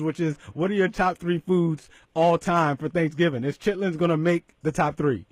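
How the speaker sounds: ordinary talk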